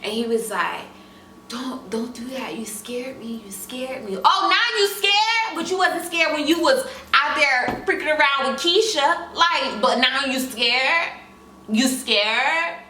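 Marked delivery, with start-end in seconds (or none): 1.5-4.2 hushed, soft pleading; quiet plea. Retold or imitated. Boyfriend quietly begging his girlfriend not to vanish. 4.2-12.8 mocking anger. Girlfriend mocking her cheating boyfriend's fear. She mocks boyfriend angrily.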